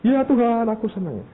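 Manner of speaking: high, eager reply to God; eager answer. Retold or imitated. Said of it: "Terus Tuhan bilang". (menjawab Tuhan dengan penuh semangat)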